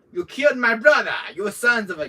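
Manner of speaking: high, loud cartoon shout of rage; mock angry yell. Cartoon character yelling at his brother's killers, in a high loud hammed-up rage. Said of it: "where it's like".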